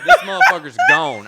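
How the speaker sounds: high, loud laugh through words. Real, not acted. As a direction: burst out laughing mid-line. Laughing at own story.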